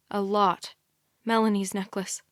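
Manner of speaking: ordinary delivery, nothing marked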